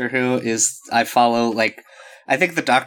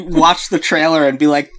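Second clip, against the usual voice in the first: ordinary delivery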